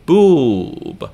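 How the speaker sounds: boob held long, high and loud; long syllable. Shown as a demonstration. Teacher showing the second, long syllable, drawn-out boob. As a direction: stretch the oo in "boob". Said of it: "and then a long syllable".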